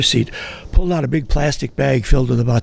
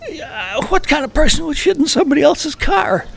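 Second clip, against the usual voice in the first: stunned driver. Retold or imitated. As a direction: voice the driver as a wounded kid. Driver quoted, indignant.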